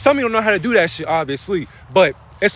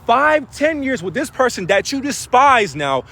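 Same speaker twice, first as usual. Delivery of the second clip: ordinary talk, nothing marked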